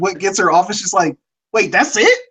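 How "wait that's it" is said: mock disbelief with rising questions; incredulous reaction. Viewer reacting to a tame confession, amused incredulity.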